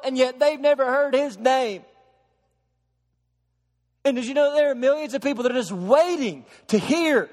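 high and loud, preaching at full force; impassioned preaching. Acted. Preacher to congregation about the unreached millions, impassioned and soaring. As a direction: lift the room with burning urgency.

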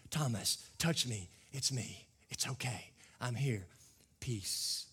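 soft, low reassurance; Jesus tenderly reassuring Thomas; compassionate Jesus. Acted. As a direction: comfort him quietly. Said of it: "He does it with compassion".

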